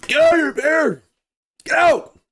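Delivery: high, loud shout to drive off a bear; yelling. Shown as a demonstration. Showing how you yell at a bear, high loud shout. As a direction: bark it out to scare the bear. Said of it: "to yell at 'em".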